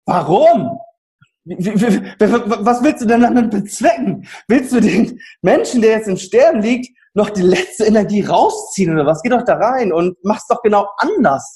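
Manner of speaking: retold exasperated questioning; indignant challenge. Retold own challenge to staff.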